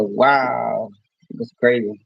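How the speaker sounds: usual speaking voice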